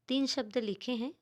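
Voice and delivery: ordinary talk, nothing marked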